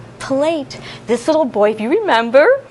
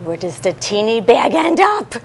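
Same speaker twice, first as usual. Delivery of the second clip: usual speaking voice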